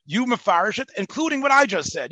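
hammed-up eager retelling; excited quote. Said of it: "Anand says".

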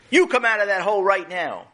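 high-pitched farmer's command; scolding order. Acted.

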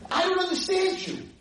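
very high, exasperated yell; screaming. Retold or imitated. Former student reproaching her rabbi, screaming. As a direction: imitate her shrieked reproach. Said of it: "she's screaming at me again".

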